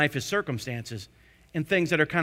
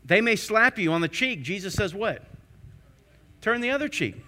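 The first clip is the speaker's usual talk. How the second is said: normal talking voice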